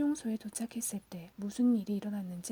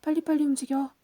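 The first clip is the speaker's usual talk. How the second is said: louder, higher hurry-up call; urgent mock shout, raised in pitch; mimicked foreman's shout; 5 semitones higher, 6 dB louder than usual. A narrator's reading. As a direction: imitate a foreman yelling orders. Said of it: "소리치는 거야".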